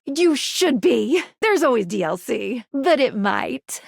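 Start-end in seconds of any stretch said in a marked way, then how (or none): none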